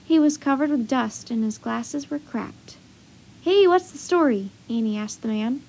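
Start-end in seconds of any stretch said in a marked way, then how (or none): 3.4-4.5 lightly voiced reporter, pitched up; newspaper reporter. A narrator's reading. Annie questions the man like a reporter, lightly mimicked. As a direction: playfully imitate a nosy reporter. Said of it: "sounded just like the newspaper reporter".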